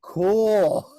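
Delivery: high, loud stunned "Cool."; stunned friend. Retold or imitated. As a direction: say "Cool." like someone in total shock. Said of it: "All he could say was like".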